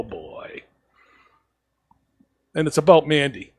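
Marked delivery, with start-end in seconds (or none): 0.0-0.6 lower, dropped to a hush; sinking dread under the breath; quiet, low resigned groan-word; dreading oh boy. Retold or imitated. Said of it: "She goes".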